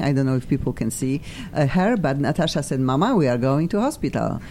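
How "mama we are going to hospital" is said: usual speaking voice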